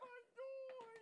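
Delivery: put-on high sobbing child voice. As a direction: whimper it out through tears. Child crying.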